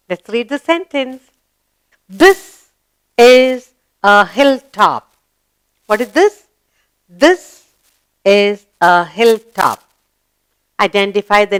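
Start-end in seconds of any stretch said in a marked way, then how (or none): 2.1-5.2 loud, slow, word-by-word reading; slow model reading. Shown as a demonstration. Teacher reading the hilltop sentence to pupils, slow model reading. 7.1-9.8 slow reading, pause after first word; slow model reading. Shown as a demonstration. Teacher repeating the hilltop sentence to pupils, slow model reading.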